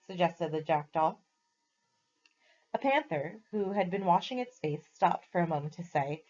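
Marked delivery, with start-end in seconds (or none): none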